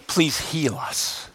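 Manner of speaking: very quiet, slow, low plea; soft plea. Acted. Lepers plead with Jesus for healing, softly. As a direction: beg softly, almost under the breath.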